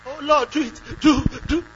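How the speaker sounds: mock desperate begging to God; begging prayer. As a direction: plead desperately like a beggar. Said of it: "You are begging".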